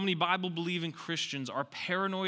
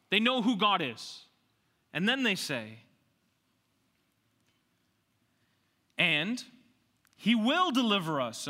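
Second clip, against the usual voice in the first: loud, high-pitched sermon delivery; full-force preaching; 8 semitones higher, 6 dB louder than usual. Real, not acted. Preacher declaring God's power to save, full-force preaching. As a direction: punch each declaration out to the congregation.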